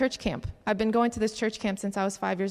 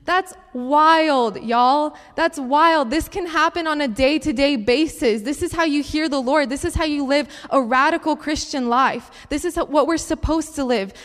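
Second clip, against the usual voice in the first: louder, higher, driving preacher voice; full-force preaching; 4 semitones higher, 6 dB louder than usual. Acted. Youth speaker rallying the congregation, full-force preaching. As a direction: preach it out to the crowd.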